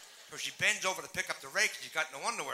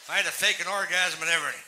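loud punchline; 9 dB louder than usual. Real, not acted. Punchline shouted.

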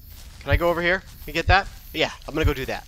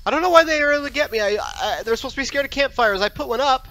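higher-pitched, aggrieved protest; baffled complaint; 8 semitones higher than usual. Real, not acted. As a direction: protest that it makes no sense. Complains game rule failed.

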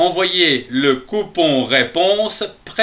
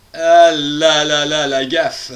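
rueful dismay; 2 semitones lower, slower than usual. Acted. Rueful about the blunder.

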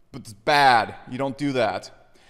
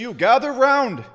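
calling out; 7 semitones higher, 5 dB louder, slower than usual. Acted. Calling the crowd in.

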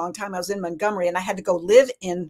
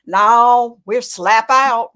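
loud, drawn-out shopkeeper's no; trader's punchline; 7 dB louder, much slower than usual. Retold or imitated. Trader telling customers he is out, loud and slow. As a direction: belt out the storekeeper's slow answer.

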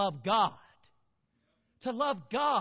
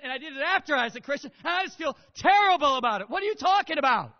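distressed confession; 3 semitones higher, 9 dB louder, faster than usual. Retold or imitated. Voicing himself before the Lord.

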